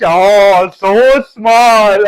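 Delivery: loud, high gushing imitation; mock cooing. Imitating people hearing someone finished school at six, mock cooing. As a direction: gush loudly as if over something tiny.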